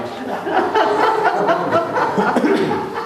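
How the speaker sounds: long high-pitched laugh; high burst of laughter. Real, not acted. Laughing at his own joke.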